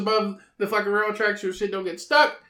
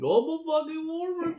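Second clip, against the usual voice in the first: higher squeaky mock; 12 semitones higher than usual. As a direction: squeak it mockingly. Mocking the phrase in a high voice.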